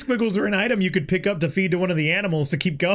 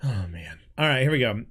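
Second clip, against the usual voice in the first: ordinary talk, nothing marked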